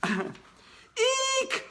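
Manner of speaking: very high squealing eek; shrill scream. Acted. Girls in class spotting the bear, high squeal. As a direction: squeal like a frightened girl. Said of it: "went all the girls".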